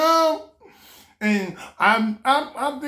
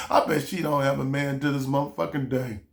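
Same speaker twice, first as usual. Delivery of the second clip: ordinary talk, nothing marked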